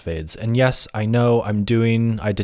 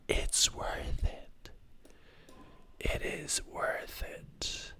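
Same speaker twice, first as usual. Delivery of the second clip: whisper; 17 dB quieter, much slower than usual. Acted. Dramatic aside about editing time.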